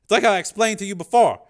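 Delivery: nervous over-explaining; strained Dev. A narrator's reading. Dev lying to the program, nervous.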